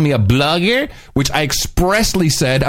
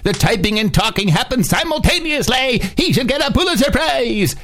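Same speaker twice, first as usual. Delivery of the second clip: higher infomercial pitchman read; 8 semitones higher than usual. Acted. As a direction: sell it like a TV pitchman. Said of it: "That would be your pitchman voice".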